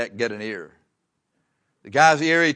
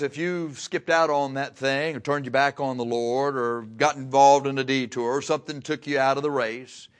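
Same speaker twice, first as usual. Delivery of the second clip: ordinary talk, nothing marked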